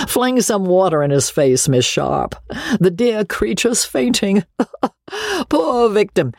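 high-pitched mock concern with laughter; hammed-up pity from a jeering old gentleman; pitiless teasing. A narrator's reading. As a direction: make a merciless show of fussing over him. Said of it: "continued the pitiless old gentleman".

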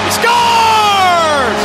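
drawn-out high shouted goal call. Real, not acted. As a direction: belt out the goal slowly.

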